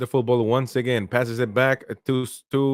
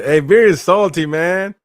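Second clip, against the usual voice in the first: loud playful jab at a salty viewer; loud teasing call-out; 6 semitones higher, 8 dB louder than usual. Real, not acted. Streamer ribbing viewer Beer in chat, loud and teasing. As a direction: shout it out high and loud, ribbing a friend.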